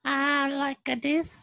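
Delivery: lightly put-on creepy voice; a hint of a creepy Jesus. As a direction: sound oddly creepy and childlike. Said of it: "what did he sound like".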